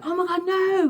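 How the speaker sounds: high-pitched imitation of comic alarm; mock dismay. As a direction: act out his panic as the door closes. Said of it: "like that".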